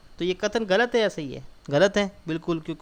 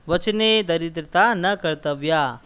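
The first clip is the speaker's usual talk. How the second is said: recitation; 5 dB louder, slower than usual. Shown as a demonstration. Teacher reciting Sanskrit sentence.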